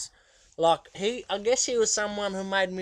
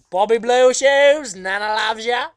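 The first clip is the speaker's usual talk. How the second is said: much louder, higher, slow granny call; doting Nana; 7 semitones higher, 14 dB louder, slower than usual. Retold or imitated. Nana cheering Bobby on at his gig, loud and slow. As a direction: sing it out proudly across the crowd like a nana. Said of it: "she'd be like".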